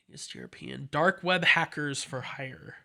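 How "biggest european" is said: whispered read; whispered listing read. Real, not acted. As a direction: breathe the words out. Reads listing.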